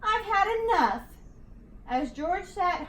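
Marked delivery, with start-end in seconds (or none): 0.0-1.0 higher, louder exasperated cry; frustrated cry. A narrator's reading. George exhausted on the mountain, frustrated cry. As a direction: give up in loud frustration. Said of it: "he cried".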